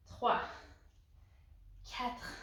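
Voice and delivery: ordinary talk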